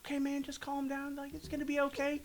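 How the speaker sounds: calm him down gently; soothing partner. Retold or imitated.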